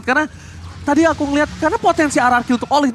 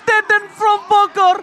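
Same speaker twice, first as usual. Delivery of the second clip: high, slow, loud hype shout; fighting game announcer; 9 semitones higher, 4 dB louder, slower than usual. Acted. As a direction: call the player out to the crowd. Hype call to the player.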